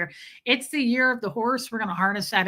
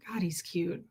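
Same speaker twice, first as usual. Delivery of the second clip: whisper; 4 semitones lower, 10 dB quieter than usual. Real, not acted. Cooing over her cat on stream.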